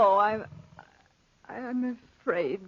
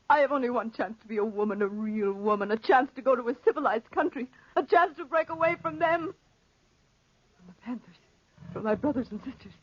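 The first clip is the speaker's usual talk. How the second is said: rushed confession; faster than usual. Acted.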